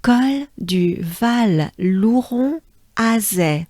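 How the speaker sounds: "Col du Val Louron Azé" slowed right down, each word separate; careful slow repeat of "Col du Val Louron Azé"; slow retake. Shown as a demonstration. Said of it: "Let me do it again".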